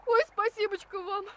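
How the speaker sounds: crying with relief; tearful. Acted. Rescued woman thanking her helper, tearful. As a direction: thank him through tears. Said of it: "Ладно, не плачьте".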